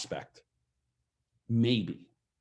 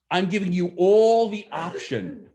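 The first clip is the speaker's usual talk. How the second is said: ordinary delivery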